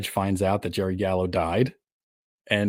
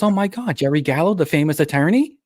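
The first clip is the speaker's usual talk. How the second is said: high-pitched mock amazement; amazed girlfriend; 8 semitones higher, 4 dB louder than usual. Girlfriend hearing the famous attorney's name, high and mock-impressed. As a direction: play her gushing recognition, pitched up.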